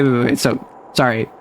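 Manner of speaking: ordinary talk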